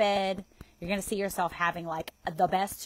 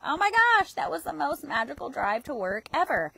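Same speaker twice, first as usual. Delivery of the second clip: high, bright burst on "Oh my gosh"; delighted to yourself after a magical drive; excited gush; 5 semitones higher than usual. Pretend. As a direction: gush like the best thing just happened. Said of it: "just say to yourself".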